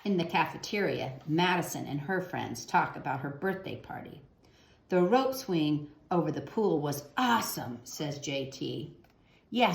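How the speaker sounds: put-on gentle storybook narration for kids; reading to children. A narrator's reading. Teacher reading a picture book to children, gentle storytelling. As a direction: read the picture book aloud to young listeners.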